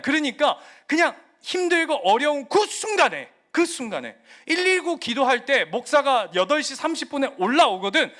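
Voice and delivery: loud, high, urgent exhortation; forceful preaching. Real, not acted.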